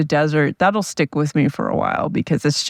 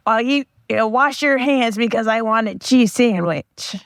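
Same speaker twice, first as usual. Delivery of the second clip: higher, mock friend voice telling a joke; imitating my friend; 6 semitones higher than usual. To her viewers, retelling a friend's joke in his mocked, higher voice. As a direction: put on a friend's joking voice, pitched up. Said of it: "I'm going to try to impersonate my friend telling the joke".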